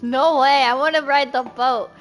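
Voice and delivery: loud, eager outburst; excited refusal. Real, not acted. Player rejecting the option to stay behind, loud and eager. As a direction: burst out loudly against the idea.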